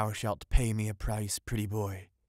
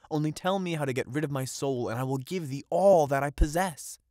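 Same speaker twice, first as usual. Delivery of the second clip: louder, higher, rushing plea; eager pleading; 6 semitones higher, 7 dB louder than usual. Acted. Fisherman pleading with the witch, rising and louder. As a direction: beg her, building to a cry.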